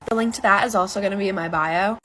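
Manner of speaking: ordinary delivery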